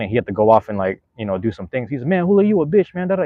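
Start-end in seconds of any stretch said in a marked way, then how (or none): 2.1-3.2 much higher mock heckler taunt; rejected fan insulting Julio in a high mocking voice. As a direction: sneer it like a bitter fan. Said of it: "he was talking crazy shit to Julio".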